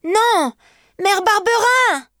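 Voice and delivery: put-on very high, drawn-out child's wail; slow high-pitched plea; desperate cry. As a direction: refuse and call out for mother. Boy refuses, calls for mother.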